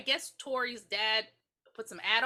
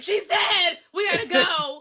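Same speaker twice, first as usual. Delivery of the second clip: much louder, higher hurried call; urgent shout; 7 semitones higher, 11 dB louder, slower than usual. Retold or imitated. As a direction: hurry everyone into the car. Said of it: "she's like".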